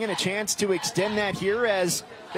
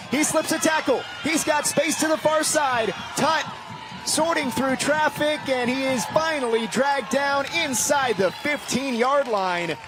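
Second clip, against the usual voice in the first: excited play call; 5 semitones higher than usual. Real, not acted. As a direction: call the return like he might score. Punt return breaking loose.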